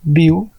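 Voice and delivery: ब्यू as one separate syllable; syllable demo. Shown as a demonstration. Teacher isolating a syllable for learners, ब्यू said on its own. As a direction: say ब्यू alone, the first beat of beautiful. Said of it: "ये एक syllable हो गया".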